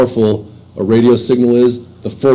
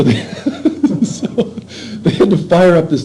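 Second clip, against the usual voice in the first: laughing, higher and slower; 8 semitones higher than usual. Real, not acted. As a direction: laugh through the words. Laughing at the absurd story.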